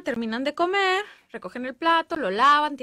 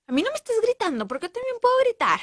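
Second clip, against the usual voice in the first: higher and rising, mock angry coworker shouting back; defensive shout; 9 semitones higher, 4 dB louder, faster than usual. Coworker blamed for the report, mock angry shout. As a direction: snap back like someone who feels accused.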